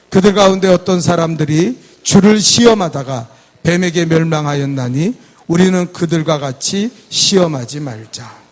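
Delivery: loud, measured congregational reading; projected Bible recitation; scripture read aloud. Acted. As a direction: read the verse out for all to follow.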